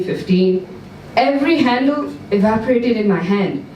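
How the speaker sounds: plain reading voice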